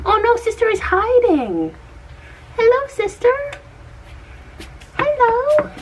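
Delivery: very high, calling coo. Real, not acted. Calling very high to the hiding sister puppy.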